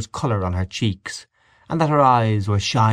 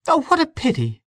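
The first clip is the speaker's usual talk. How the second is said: very high, dismayed exclamation; crying out. A narrator's reading. Mary Jane as the singing stops, high dismayed cry. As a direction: lament it in a high character voice. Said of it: "she cried".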